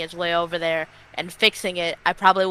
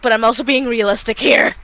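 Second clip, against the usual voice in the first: raised voice, arguing the point; insistent protest; 5 semitones higher, 12 dB louder than usual. Real, not acted. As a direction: push back loudly and firmly. Player defends self in table dispute.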